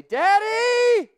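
high, loud, slow pleading cry; desperate plea to God. Shown as a demonstration. Believer begging God for help, high and desperate. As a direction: cry out to God like a helpless child. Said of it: "the next thing that you need to do is say".

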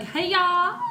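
high, rising Tennessee twang. Retold or imitated. Recalling the twang picked up in Tennessee, exaggerated Southern twang. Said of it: "I picked up a twang".

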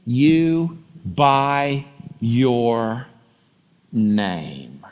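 hushed, slow scripture promise; slow tender reading. Acted. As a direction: linger on each word tenderly. God's promise read slowly.

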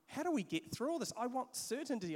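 high, pleading voice of an anxious public; anxious plea. Retold or imitated. Pastor voicing a worried public asking for certainty, high pleading. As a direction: cry out for certainty like a worried crowd.

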